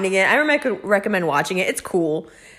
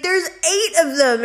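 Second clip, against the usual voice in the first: mom; 10 semitones higher, 4 dB louder than usual. Retold or imitated. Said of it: "she was like".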